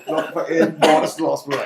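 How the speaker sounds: ordinary talk, nothing marked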